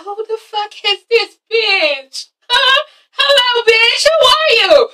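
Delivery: high, slow-building mock outrage; jealous confrontation. Jealous Asha confronting Derek's date, acted outrage. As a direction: challenge the rival in a high, hammed-up voice.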